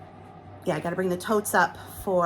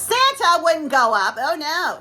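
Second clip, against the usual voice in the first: usual speaking voice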